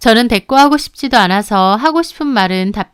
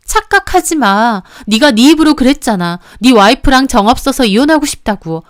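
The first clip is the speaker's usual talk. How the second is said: higher and louder, fed up; furious retort; 3 semitones higher, 5 dB louder than usual. Acted. Mistress tears into the husband, furious. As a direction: snap back, building to a yell. Quarrel in recorded phone call.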